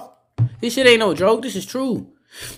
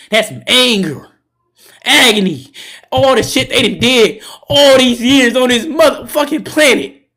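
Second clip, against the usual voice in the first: loud, higher angry outburst; rising rage; 4 semitones higher, 6 dB louder than usual. Real, not acted. Streamer venting years of pain to chat, loud and angry. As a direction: let the anger boil over.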